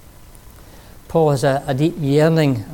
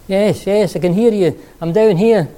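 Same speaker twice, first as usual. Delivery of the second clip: calling up; 6 semitones higher, 6 dB louder than usual. Acted. Jack shouting up to voice.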